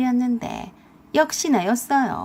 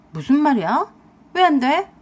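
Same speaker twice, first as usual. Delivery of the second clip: raised, questioning protest; incredulous demand; 3 semitones higher, 5 dB louder than usual. A narrator's reading. Wife asks husband why not that day, incredulous demand. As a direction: lift it sharply into a question.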